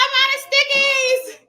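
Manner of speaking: high, loud, drawn-out wail; hammed-up distress; mock panic. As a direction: imitate a frantic mother in a slow high wail. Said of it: "She was all panicking".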